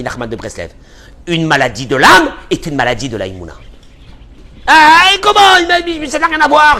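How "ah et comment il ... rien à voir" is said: shrill, loud upset voice; high loud anguished protest; high shouting complainer. Retold or imitated. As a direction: play a distraught man who protests. Acts out a troubled person.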